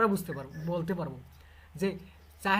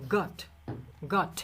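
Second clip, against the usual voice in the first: গট said British style with a rounded অ vowel; British got; 2 semitones lower, much slower than usual. Shown as a demonstration. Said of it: "ব্রিটিশদের ক্ষেত্রে উচ্চারণটা একটু আলাদা হবে". (British উচ্চারণে গট, গোল অ ধ্বনি)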